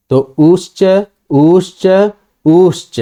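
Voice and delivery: उश्च three times, the opening u held longer each time; short, long, prolonged u in उश्च; three vowel lengths. Shown as a demonstration. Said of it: "ये तीनों उकार".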